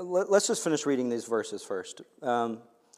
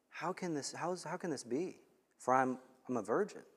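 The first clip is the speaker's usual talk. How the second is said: much softer, hushed genuine wonder; sincere Mary; 12 dB quieter than usual. Retold or imitated. Mary asking the angel, soft sincere wonder. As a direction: ask it quietly, truly wanting to know. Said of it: "Mary was sincere and genuine".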